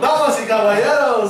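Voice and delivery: loud high Spanish ring announcer; lucha libre announcer. Retold or imitated. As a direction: belt it out to the arena crowd. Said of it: "Can you imagine him doing a promo?".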